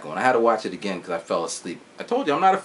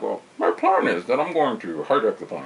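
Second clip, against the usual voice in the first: put-on metal machine villain voice; hammed-up mechanical villain; Bane's voice. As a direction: intone the scheme as a masked villain. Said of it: "saying it with his big, like, metal machine voice".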